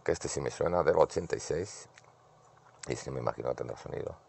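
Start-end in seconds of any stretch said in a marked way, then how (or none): none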